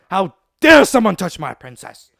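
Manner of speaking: loud, hammed-up indignant hero; mock outrage. Boy reacting to his girl being bullied, mock outrage. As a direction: burst out in theatrical outrage.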